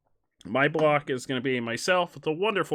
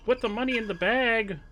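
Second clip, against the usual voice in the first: bank robber; 8 semitones higher, 4 dB louder than usual. Pretend. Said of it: "this is a robbery".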